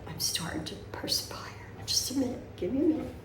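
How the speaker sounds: breathless flustered whisper. Real, not acted. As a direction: whisper it while worked up. Flustered, getting up for fan.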